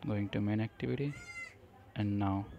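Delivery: ordinary talk, nothing marked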